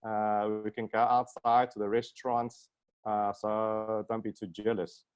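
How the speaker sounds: ordinary delivery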